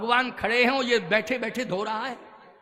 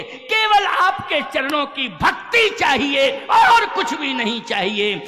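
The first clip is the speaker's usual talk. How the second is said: loud, high, drawn-out devotee's cry; fervent devotional plea; 3 semitones higher, 6 dB louder than usual. Acted. Devotee begging God only for devotion at his feet, fervent plea. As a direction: plead with rising force, stretching the words.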